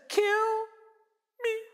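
high, whiny kill me; self-pitying plea. Acted. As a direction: whine it up high, pitying yourself. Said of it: "self-piteously says".

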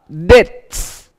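"Dates" ending in a hissed ts; final S voiceless. Shown as a demonstration. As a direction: say "Dates" with a clear voiceless s after the t. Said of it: "S này được phát âm là".

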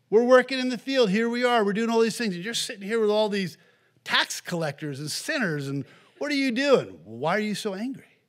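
loud, high, indignant complaint; the older brother protesting to his father, loud and resentful. Retold or imitated. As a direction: whine it like a wronged son.

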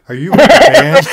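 high, loud mock female fan; imitating eager woman. Bandmate mimicking the chick who came up to TJ, high loud fan voice. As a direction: squeal it like a keen groupie.

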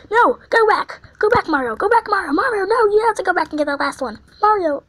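high, loud frantic pleading; urgent yelling. Real, not acted. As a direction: call out urgently to a game character. Yelling at Mario in game.

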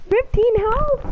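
disbelief. Real, not acted. Repeating in disbelief.

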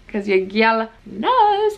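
slow, sassy, knowing; sassy drawl. Acted. Boasting she knows her shade, slow sassy drawl. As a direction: stretch it out with swagger.

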